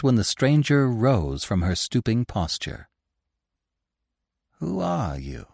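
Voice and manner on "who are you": soft weak whisper of a question. A narrator's reading. Weak Lupin asking his rescuer, soft whisper. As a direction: breathe it out, barely voiced. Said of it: "he whispered".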